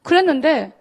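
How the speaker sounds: ordinary talk, nothing marked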